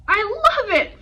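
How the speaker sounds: loud, high, delighted hoot. Acted. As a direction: belt it out like a jolly uncle. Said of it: "Fred hooted".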